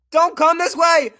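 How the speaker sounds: scared scream of warning; frightened yell. Real, not acted. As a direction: shout at the monster to stay back. Monster heading toward him.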